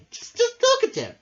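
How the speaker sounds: high tiny bee voices; a chorus of curious little bees. Acted. Little bees react to the new pupil, high and tiny. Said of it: "they said".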